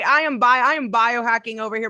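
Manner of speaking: ordinary talk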